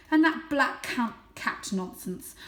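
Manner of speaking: loud, scornful cat voice; scoffing father. Acted. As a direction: dismiss the tale with scorn.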